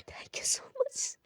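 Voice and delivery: breathless whispered thanks; hushed whispered thanks; grateful whisper. Acted. Tearful grateful prayer whisper.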